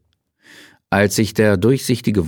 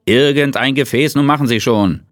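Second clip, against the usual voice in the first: impatient urging; 6 semitones higher, 4 dB louder than usual. A narrator's reading. Moses impatiently urges colleague.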